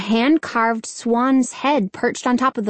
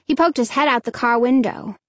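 ordinary delivery

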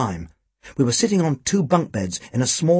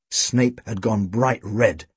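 plain reading voice

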